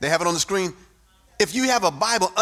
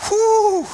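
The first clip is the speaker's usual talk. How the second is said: breathless whew; 12 semitones higher, 4 dB louder than usual. Real, not acted. Exhale after preaching peak.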